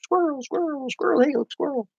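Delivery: high, soft squeaky "squirrel!" as if losing focus; distracted squirrel cry. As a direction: yelp it like a distracted dog spotting a squirrel. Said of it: "I'm like".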